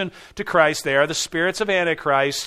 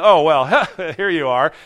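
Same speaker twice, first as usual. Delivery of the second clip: sarcastic; 6 dB louder than usual. Shown as a demonstration. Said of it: "There's no sarcasm in this".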